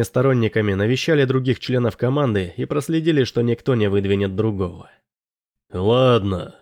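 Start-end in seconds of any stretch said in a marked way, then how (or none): 5.7-6.5 resigned, understated sigh; heavy sigh. A narrator's reading. Morley accepting the unwelcome vote result, sighing resignedly. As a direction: give in with a touch of weariness. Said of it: "тяжело вздохнул Морли".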